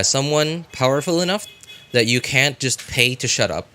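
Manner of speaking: ordinary delivery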